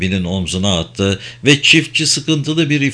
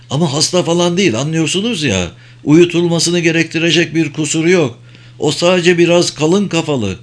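hasty and nervous; 6 semitones higher than usual. A narrator's reading. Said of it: "Aceleyle sinirli bir şekilde ekledi".

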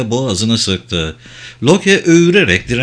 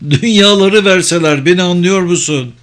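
earnest insistence; 5 semitones higher, 4 dB louder than usual. A narrator's reading. Loke insists, full of sorrow.

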